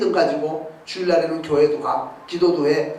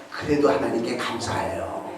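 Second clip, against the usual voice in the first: ordinary talk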